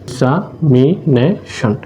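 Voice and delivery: Examination very slowly in four chunks, এক্সা, মি, নে, শন; split into syllables. Shown as a demonstration. Teacher showing a polysyllable, examination said slowly piece by piece. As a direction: break examination into four parts with long gaps between. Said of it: "এ হচ্ছে চার ভাগ".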